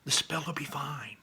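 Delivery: high, whispery, soothing repeat; hushed reassurance. Acted. Barley calming his doubting brother, hushed reassurance. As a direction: soothe him in a stage whisper.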